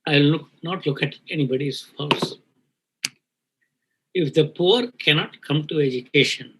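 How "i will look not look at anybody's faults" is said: choked up, long pauses between words; fighting back tears, breath catching; emotional, catching breath. Real, not acted. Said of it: "I'm emotional".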